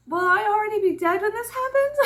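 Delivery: put-on high child's voice, laughing through it; sweet little girl. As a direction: play a doe-eyed girl asking innocently. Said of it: "doe-eyed girl was like".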